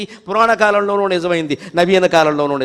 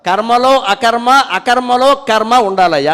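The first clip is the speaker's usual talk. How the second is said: slow, louder emphatic quote of the saying; quoted maxim; 4 semitones higher, 7 dB louder, slower than usual. Retold or imitated. Preacher quotes the sage's teaching to the audience, slow and loud. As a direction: proclaim the words కర్మలో అకర్మ slowly and loudly. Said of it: "Ramana Maharshi చెబుతారు".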